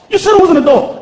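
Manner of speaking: put-on high child voice; arguing child. Son argues back at stepdad, protesting child voice. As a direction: protest like a little boy.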